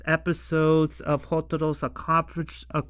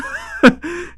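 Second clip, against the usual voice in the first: shrill laugh bursting out; high burst of laughter; 14 semitones higher, 8 dB louder than usual. Real, not acted.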